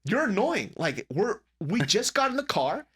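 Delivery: high-pitched, exasperated brother; annoyed telling-off. Retold or imitated. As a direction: imitate a brother snapping at dad. Brother tells dad off.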